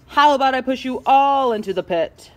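loud, altered character voice; irate threat. Acted. Pushpin threatens the others, irate.

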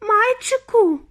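high, loud motherly cry; shrieked exclamation; exclaiming mother. A narrator's reading. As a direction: call out high to your child. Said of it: "вигукнула пані Т В".

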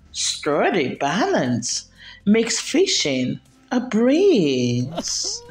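usual speaking voice

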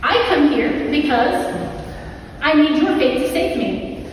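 ordinary talk, nothing marked

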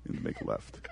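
mild low impression of the clerk; imagined hotel clerk. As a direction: give directions as a low-key foreign clerk. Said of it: "he probably would have said".

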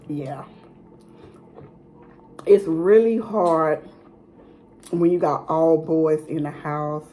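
sandwich in hand, chewing; talking while eating. Real, not acted. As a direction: mouth partly full. Seen 3: Holds sandwich while talking; bites it near the end (8-9s).

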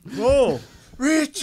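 loud, high, drawn-out shout; calling out. Retold or imitated. Calling out to Richie as they are left behind, high loud holler. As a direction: holler the name and stretch it out.

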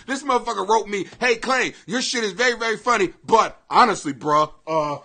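ordinary talk, nothing marked

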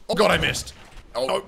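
loud sudden cry at a missed jump; startled gamer outburst; dismayed yelp. Real, not acted. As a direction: shout it as the jump fails.

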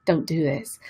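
soft, low warning; inner voice. Acted. Intuition warns the lover against the relationship, quiet inner voice. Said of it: "your intuition is saying to you".

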